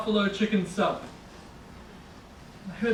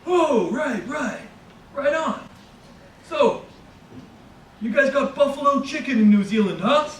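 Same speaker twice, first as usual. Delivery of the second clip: enthusiastic stranger; 7 semitones higher, 5 dB louder than usual. Retold or imitated. Bodega stranger praising the narrator's order, high and loud. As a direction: gush over the sandwich like an overeager local.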